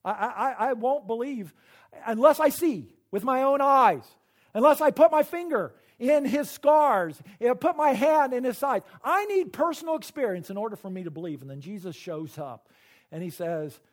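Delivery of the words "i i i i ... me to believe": doubting Thomas insisting. Retold or imitated. Thomas tells the disciples he needs proof, loud and insistent. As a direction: hammer out each condition like a stubborn doubter. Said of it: "Thomas says".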